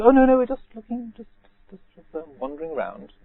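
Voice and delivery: high, flustered lady's voice, the last words fading; flustered woman. Retold or imitated. Trespassing woman brushing off the monk, high and evasive.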